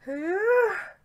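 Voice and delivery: high, loud nervous squeak; high nervous squeal. Retold or imitated. Fan recalling queuing for an actor's photo, nervous squeal. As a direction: let out a loud, high-pitched nervous noise. Reenacts nerves while queuing.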